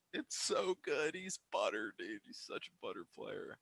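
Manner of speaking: very soft, low, near-whispered awe; hushed admiration. Real, not acted. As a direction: savour the play under your breath. Savouring the shot.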